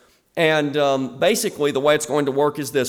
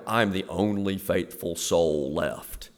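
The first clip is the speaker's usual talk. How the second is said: softer, lower, self-pitying whine; mock lonely martyr's prayer, softer and lower; 6 semitones lower, 5 dB quieter than usual. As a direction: pray like you're the last faithful soul, a bit hammed-up. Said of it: "Have you ever prayed to God that way?".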